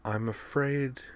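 understated dryness, softly voiced; dry. A narrator's reading. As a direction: say it quietly and dryly. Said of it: "she said dryly".

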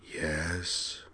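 very soft, eerie alien voice; hushed, eerie voice. A narrator's reading. Alien in encounter suit answering Derek, hushed and eerie. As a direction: hush it right down, otherworldly. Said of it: "rather hushed and eerie voice".

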